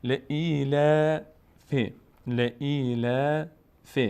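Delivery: "ل ای ل ف" slow, drawn out, with thin front "laa"; thin lam demonstration. Shown as a demonstration. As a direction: stretch each long vowel in "ل ای ل ف", keeping it light. («ل ای ل ف» کشیده، شمرده و باریک)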